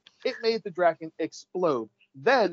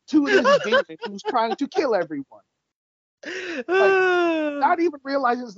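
incredulous rising outburst; 10 semitones higher than usual. Real, not acted. Incredulous retelling of absurd scene.

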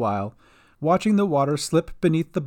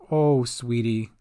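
tender sympathy; 4 semitones lower, slower than usual. Acted. Cat comforts sick friend.

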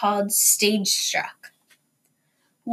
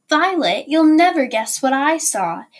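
higher, louder excited exclamation; exclaimed; 5 semitones higher, 5 dB louder than usual. A narrator's reading. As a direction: burst in bursting with news. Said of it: "Jessie exclaimed".

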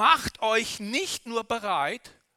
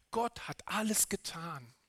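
whispering; 3 semitones lower, 7 dB quieter than usual. Real, not acted. Whispered line.

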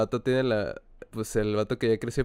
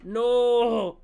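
high, loud cry of protest; shocked shout; 11 semitones higher, 12 dB louder, much slower than usual. Real, not acted. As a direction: yell out in mock horror. Reacción al tema de Disney. (grito de protesta fingido)

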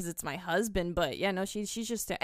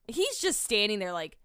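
ordinary delivery

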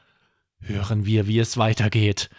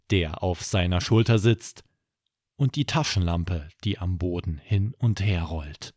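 plain reading voice

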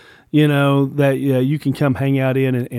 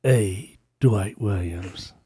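movie trailer voice; 6 semitones lower, 5 dB quieter, much slower than usual. Pretend. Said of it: "That's my best movie tone voice".

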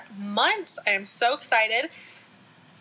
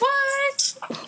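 even higher squeaky what; mock surprise. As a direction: echo the fake amazement. Echoing mock amazement.

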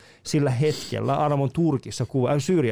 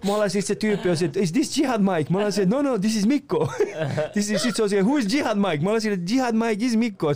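animated retelling; 7 semitones higher than usual. Retold or imitated. Excited retelling of police exchange.

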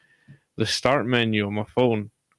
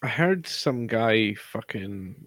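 ordinary talk, nothing marked

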